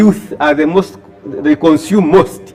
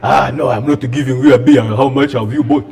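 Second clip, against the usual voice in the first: put-on Muchiga accent; 6 semitones lower, 4 dB quieter than usual. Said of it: "That's in Muchiga".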